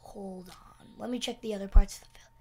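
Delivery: hushed fast whisper. Real, not acted. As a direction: whisper so mobs can't hear. Sneaking, spots creeper.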